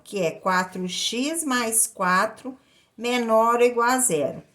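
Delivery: usual speaking voice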